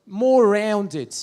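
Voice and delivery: loud, rounded Australian English; Australian accent. Shown as a demonstration. Comedian showing how Australians differ from British, Australian accent. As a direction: round it hard, Australian-style. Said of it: "You see what I did there?".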